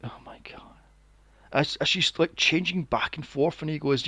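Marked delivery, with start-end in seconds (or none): 0.1-0.6 breathless, unvoiced whisper; whispered oh my God. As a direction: mouth it in shocked awe. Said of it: "I was like".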